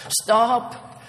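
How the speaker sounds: very high, panicked royal cry; frantic high-pitched plea; desperate pleading. A narrator's reading. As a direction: beg them in a high wail. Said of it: "pleaded the king".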